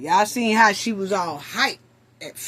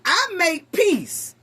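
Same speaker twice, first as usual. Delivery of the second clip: higher, louder mocking parrot of her words; sarcastic mock quote; mocking imitation; 11 semitones higher, 8 dB louder than usual. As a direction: mimic her smugly, overdone. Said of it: "She said".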